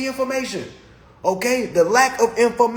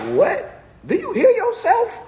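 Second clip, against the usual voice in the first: incredulous; 12 semitones higher than usual. Retold or imitated. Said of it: "I'm like".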